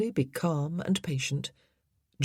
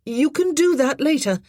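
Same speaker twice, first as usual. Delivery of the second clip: calling up; 10 semitones higher, 7 dB louder than usual. A narrator's reading. Jean calling up to boy.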